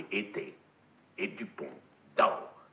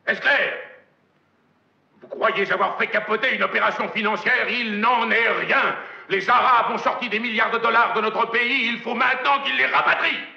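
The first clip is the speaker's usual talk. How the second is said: booming furious tirade, higher and louder; hammy stage actor; 12 semitones higher, 8 dB louder than usual. Acted. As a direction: rage at him like a tyrant. Furious executive's tirade, shouting.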